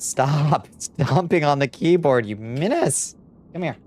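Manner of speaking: amused, fed-up plea at a pet; laughing exasperation. Real, not acted. Cat stomping on keyboard.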